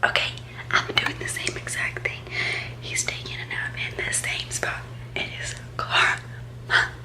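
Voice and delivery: soft unvoiced whisper. Real, not acted. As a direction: hush so nobody wakes. Whispering so he won't wake.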